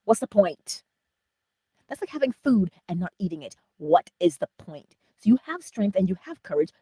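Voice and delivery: ordinary delivery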